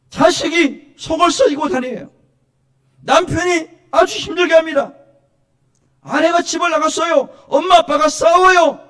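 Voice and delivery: high, loud pleading building to a shout; preacher acting out desperate prayer; crying out to God. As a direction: wail the family troubles up to heaven. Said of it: "하나님께 나와서 부르짖으라는 거예요".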